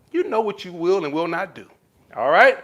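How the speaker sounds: ordinary talk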